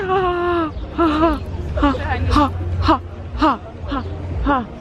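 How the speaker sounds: breathy pulsed ha laughs; huffing ha ha bursts; pulsed ha sounds. Real, not acted. Laughing in the heat.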